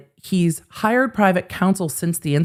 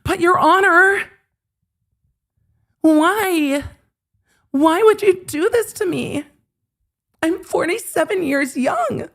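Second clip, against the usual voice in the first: whiny begging; 12 semitones higher than usual. Pretend. Mocking Ron begging the judge, very high whine. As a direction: wail like a begging child.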